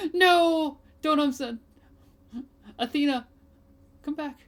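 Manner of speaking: high, loud alarmed call after someone; gasp, then plead her back in panic; calling out to Athena as she runs off, high and alarmed; panicked cry. Acted. Character cries out in panic.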